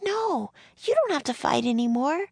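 put-on very high girl's voice; pleading young girl. A narrator's reading. Annie to John about fighting, high and pleading. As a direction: plead with him in a high, girlish voice.

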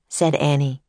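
ordinary delivery, nothing marked

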